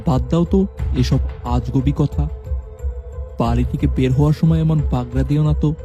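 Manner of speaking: usual speaking voice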